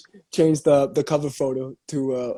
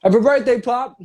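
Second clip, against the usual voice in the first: cheerful toast; 8 semitones higher, 5 dB louder than usual. Real, not acted. Toasting father's birthday.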